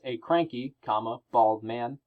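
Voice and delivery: "cranky" slowed, then a clear pause before "bald man"; pause after cranky. Shown as a demonstration. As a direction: hold a real break after "cranky". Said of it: "you definitely hear the pause there".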